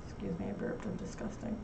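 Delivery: embarrassed whisper after a burp; whispered aside. Real, not acted. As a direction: drop to a breathy whisper, a little ashamed.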